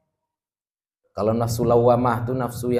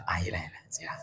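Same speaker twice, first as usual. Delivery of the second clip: unvoiced whisper aside; 6 semitones lower, 13 dB quieter than usual. Real, not acted. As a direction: whisper it quickly off to the side.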